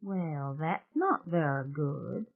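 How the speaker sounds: a soft, slow mutter; quiet, slow disapproving murmur. Acted. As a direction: mutter it under your breath. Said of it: "Celia had muttered".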